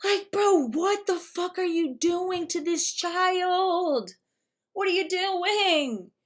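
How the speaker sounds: high, drawn-out incredulous plea; slow, high-pitched dismay; exasperated disbelief. Acted. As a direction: stretch the words out in appalled exasperation. Exasperated outburst at the mother.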